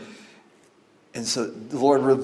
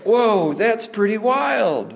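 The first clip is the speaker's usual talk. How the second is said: impressed whoa; 8 semitones higher, slower than usual. Retold or imitated. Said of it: "And they're like".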